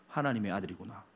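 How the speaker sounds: hushed realization. Acted. Nathanael realizing who Jesus is, hushed. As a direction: let it sink in, almost to yourself.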